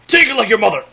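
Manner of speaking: loud, put-on devil voice; Satan. Commentator plays the devil in hell, mock devil bark. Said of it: "Satan will be going".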